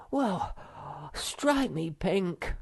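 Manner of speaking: high, slow astonished cry; amazed relief; exclamation. A narrator's reading. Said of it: "he exclaimed".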